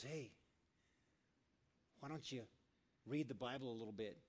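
soft, low, unhurried invitation; gentle bedside nudge. Acted. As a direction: suggest it quietly beside the bed.

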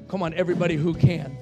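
ordinary talk

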